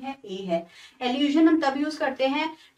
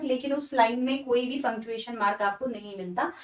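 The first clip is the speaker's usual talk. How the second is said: normal talking voice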